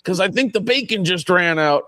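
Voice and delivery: put-on old man voice. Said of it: "the two old men".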